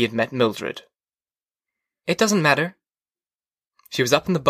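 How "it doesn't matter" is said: higher-pitched wife's voice; wife dismissive. A narrator's reading. Wife dismisses the forgotten meeting, high voice.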